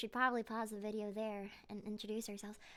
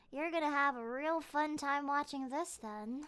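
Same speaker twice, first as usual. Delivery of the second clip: ordinary talk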